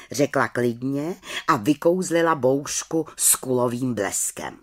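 plain reading voice

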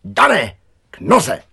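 loud, high, short dog call; hollered command. A narrator's reading. Přemek rushes out and calls his dog, hollering. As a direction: bellow the order to heel. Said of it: "a zahulákal".

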